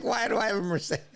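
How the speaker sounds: high, baffled disbelief. Retold or imitated. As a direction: squeak it out in disbelief. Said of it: "But I was like".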